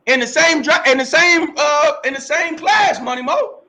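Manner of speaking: high, loud, hyped-up exclamation; excited shout. Real, not acted. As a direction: shout it out, drawing out the last phrase. Excited boast about recruits to host.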